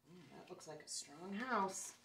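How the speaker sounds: usual speaking voice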